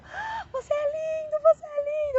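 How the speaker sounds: gasp, then high tearful marvelling; awed adoration. Retold or imitated. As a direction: re-enact adoring a husband, voice soaring high. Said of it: "eu olhei pro meu marido". (encantamento com o marido, voz aguda e chorosa)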